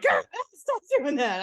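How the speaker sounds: high, laughing plea to stop; laughing protest. Retold or imitated. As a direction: protest half-amused. Retold protest to pranking guys.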